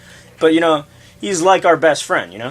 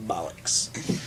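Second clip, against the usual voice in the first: whispered; 8 semitones lower, 12 dB quieter than usual. Pretend. Repeating a British swear word, fading.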